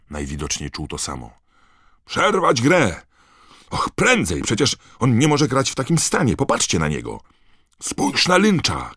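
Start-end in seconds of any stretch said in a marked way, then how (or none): 2.1-7.2 high, loud, frantic demand to stop; urgent shouting. Acted. Fan begs them to stop the match for the injured player, shouted. Harry urges stopping the game for injured Krum. 7.8-8.9 high, drawn-out cry; excited shout. A narrator's reading. Harry alerts his friend to the diving seeker, shouting. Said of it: "krzyknął Harry".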